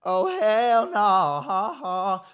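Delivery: drawn-out, high, very loud exclamation; loud refusal. Retold or imitated. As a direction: belt out an over-the-top refusal. Acted outraged refusal to the man.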